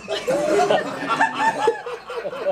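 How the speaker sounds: high burst of laughter; big laugh. Real, not acted. Laughing at his own train story. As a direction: laugh out at the memory.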